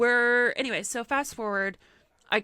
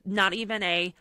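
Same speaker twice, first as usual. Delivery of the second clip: ordinary talk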